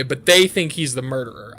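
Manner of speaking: ordinary talk, nothing marked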